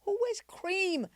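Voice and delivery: high, slow, scoffing disbelief; high mocking outrage at his outfit; incredulous mock disbelief. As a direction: scoff at the cream suit like it's absurd. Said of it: "I said".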